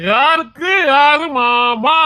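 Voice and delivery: high, slow, drawn-out challenge; indignant challenge. Acted. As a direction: stretch it out in drunken indignation. Drunk man indignantly rejects being called uncle.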